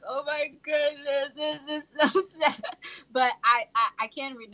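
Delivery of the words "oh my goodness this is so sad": higher, slow, drawn-out "oh my goodness"; mock reader getting choked up; mock-tearful reader. Mock tearful reader overwhelmed by a sad scene. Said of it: "We're like".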